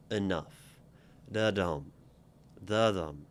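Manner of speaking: Enough as da-DUM, light then heavy; rhythmic da-dum stress demo; iambic rhythm. Shown as a demonstration. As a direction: tap out the iamb da-DUM. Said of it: "one is kind of light ... nuff comes down".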